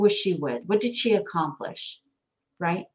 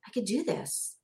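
soft, hushed realisation; quiet self-belief; 9 dB quieter than usual. Retold or imitated. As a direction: murmur it as a new private thought. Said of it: "it's like".

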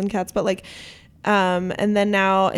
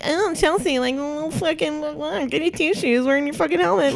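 higher, sing-song mock taunt; mocking teasing kids; 6 semitones higher than usual. Imitating schoolkids teasing her over the helmet, mock taunt. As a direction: mimic the kids who teased her. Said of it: "I was always like".

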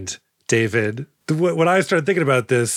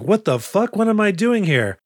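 confused henchman; 8 semitones higher than usual. Retold or imitated. Henchman in afterlife.

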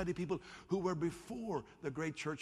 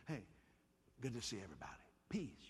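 just greet them easily; the right way, warm and natural; casual friendly greeting; 5 semitones lower, 10 dB quieter, faster than usual. Shown as a demonstration. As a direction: lower and soft, relaxed. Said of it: "You say".

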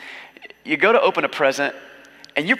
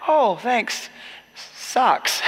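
sarcastic; 7 semitones higher, much slower than usual. Retold or imitated. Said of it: "this guy's like".

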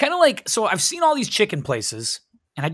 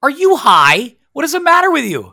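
outraged disbelief; 7 semitones higher, 6 dB louder than usual. Acted. Mock outrage at cheese hater.

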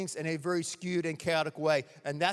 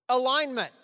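Alignment. said louder and higher, firmly; simple firm answer; 6 semitones higher, 6 dB louder than usual. Retold or imitated. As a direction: state Alignment. plainly and firmly. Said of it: "And Jesus said, simply".

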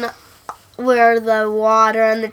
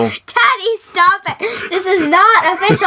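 high, laughing protest at Daddy; 7 semitones higher than usual. Real, not acted. Daughter protesting her teasing dad, laughing. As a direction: push back through giggles, pitched up.

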